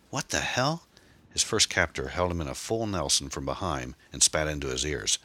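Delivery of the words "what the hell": high-pitched, pained disbelief; wailing. A narrator's reading. Attacked man protests to his captors, high-pitched wail. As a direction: cry out high in bewildered pain. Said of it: "he wailed".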